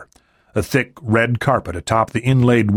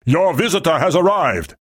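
raised, higher voice calling into the house; a pitched-up call, not much louder; calling loudly; 7 semitones higher than usual. A narrator's reading. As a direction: announce him to the next room. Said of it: "Michalikov said loudly".

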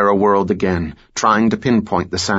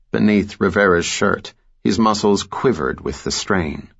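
ordinary delivery, nothing marked

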